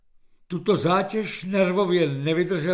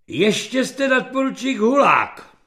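loud, raised pronouncement; menacing general; 6 semitones higher, 6 dB louder than usual. A narrator's reading. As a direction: announce it as a threat to a subordinate. General threatens the lieutenant with demotion. (výhrůžné generálské prohlášení)

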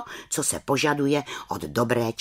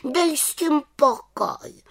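much higher, slow annoyed voice; cross little witch; slower than usual. A narrator's reading. Little witch waves off the raven, in a cross high voice. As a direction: tell him off sulkily. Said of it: "vykřikla mrzutě".

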